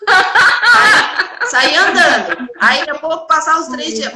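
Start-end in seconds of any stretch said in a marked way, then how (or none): 0.1-2.8 laughing. Real, not acted. Laughing at her own story.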